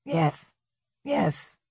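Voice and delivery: a touch of rasp, softly; quiet, lightly raspy murmur. A narrator's reading. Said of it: "said in her raspy Bonnie Tyler voice".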